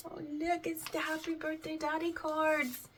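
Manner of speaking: high-pitched coo of delight; cutesy gushing. Real, not acted. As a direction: coo over something adorable. Gushing over cute birthday cards.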